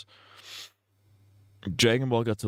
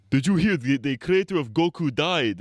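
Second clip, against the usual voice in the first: higher, louder coworker impression; 7 semitones higher, 3 dB louder than usual. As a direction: play the eager new coworker. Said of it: "he's like".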